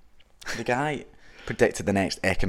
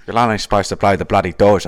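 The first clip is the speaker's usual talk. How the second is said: louder, lightly voiced Cockney film line; Michael Caine impression; 8 dB louder than usual. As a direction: deliver the famous line with a hint of London swagger. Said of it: "my Michael Caine impression".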